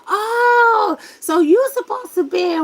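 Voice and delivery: loud, high 'aha' moment, replayed; exaggerated realization. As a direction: blurt it out as the truth dawns. Replays own realization to the man.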